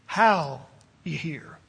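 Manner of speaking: slow, low, weight on "How"; stress on HOW. Shown as a demonstration. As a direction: draw out "How ye hear". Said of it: "He is emphasizing".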